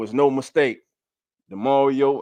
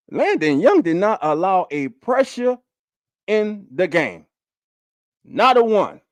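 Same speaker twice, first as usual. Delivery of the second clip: emphatic hype; 6 semitones higher than usual. Real, not acted. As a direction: hammer the point home with rising force. Hyping player, repeats the claim.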